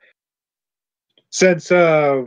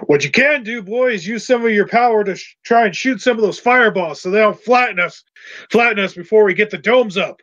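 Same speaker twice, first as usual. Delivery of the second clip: higher-pitched working character voice; Mister Bulger; 5 semitones higher than usual. Acted. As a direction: explain it as the one running the job.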